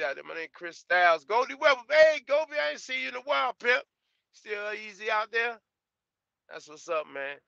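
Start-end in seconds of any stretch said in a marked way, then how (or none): 1.2-5.6 high, loud shout-out to a viewer; calling out. Real, not acted.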